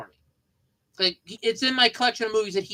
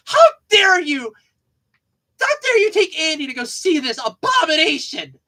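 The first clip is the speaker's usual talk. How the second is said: loud, higher, indignant scolding; mock outraged grandfather; 7 semitones higher, 8 dB louder than usual. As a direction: play the outraged grandpa telling off his son.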